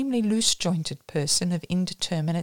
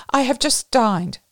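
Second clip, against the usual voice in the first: higher, louder polite excuse; raised-voice refusal; 7 semitones higher, 5 dB louder than usual. A narrator's reading. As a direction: decline politely over the noise. First, milder refusal over noise.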